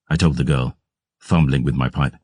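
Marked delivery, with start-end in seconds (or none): none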